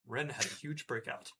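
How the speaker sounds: ordinary talk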